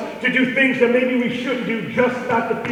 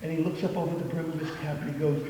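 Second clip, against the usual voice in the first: normal talking voice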